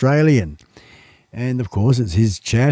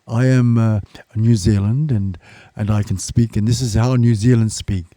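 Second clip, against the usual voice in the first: put-on low, halting New Zealand accent; soft imitation of a Kiwi speaker; 5 semitones lower, 5 dB quieter than usual. Streamer mimicking a Kiwi vlogger's demo, mock Kiwi accent. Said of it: "he's talking like a Kiwi".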